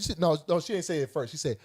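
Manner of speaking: usual speaking voice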